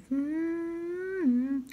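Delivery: high bored hum. Retold or imitated. Sitting bored beside his game.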